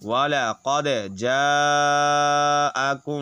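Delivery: slow chanted tajweed reading; slow recited verse; Quran recitation. Shown as a demonstration. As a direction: draw out جاءكم slowly like in prayer. Recites joined phrase slowly.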